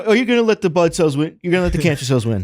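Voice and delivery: high, loud, hammed-up goading; jokey rallying shout; mock pep talk. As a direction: challenge them like an over-the-top coach.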